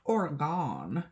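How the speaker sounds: "Oregon" drawn out as OR-gawn; slow, overdone OR-gawn for "Oregon"; mock Oregon accent. Shown as a demonstration. Joking about their own accent, Oregon stretched to OR-gawn. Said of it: "I have an Oregon".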